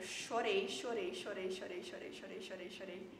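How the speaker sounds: fading, repeated word trailing into near-silence; repetição que se esvai. Retold or imitated. Repete o choro, sumindo aos poucos. (repetindo cada vez mais baixo)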